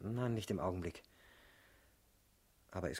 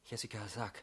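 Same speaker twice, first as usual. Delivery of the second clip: ordinary talk, nothing marked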